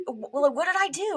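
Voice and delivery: high, anxious mock parent; flustered parent. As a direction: play the worried parent fretting. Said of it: "my parents being like".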